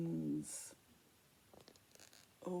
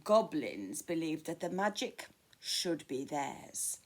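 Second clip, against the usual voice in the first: ordinary delivery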